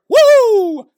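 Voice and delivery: very high, loud Flair woo; Ric Flair woo. Retold or imitated. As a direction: belt out the signature woo. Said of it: "includes the signature".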